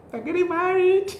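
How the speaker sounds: high, loud joyful burst; excited realization. Retold or imitated. Bride reliving the veil moment, high loud thrilled cry. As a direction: it suddenly hits you, exclaim it. Emotional realization during prayer.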